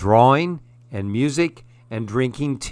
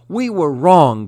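remorseful cry; 4 semitones higher, 6 dB louder than usual. A narrator's reading. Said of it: "cried the fairies".

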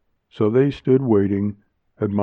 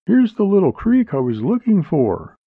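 high woman's voice, suddenly piping up; abrupt, high-pitched discovery; sudden announcement; 9 semitones higher, 4 dB louder than usual. A narrator's reading. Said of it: "Junella said suddenly".

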